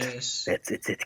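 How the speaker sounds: breathy whispered character voice. Acted. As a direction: whisper it like a soldier scout. GM voices Ignis after refusing.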